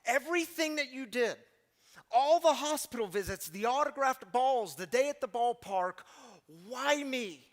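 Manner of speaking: put-on grateful young man. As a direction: ask it in disbelief at such kindness. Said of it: "he says".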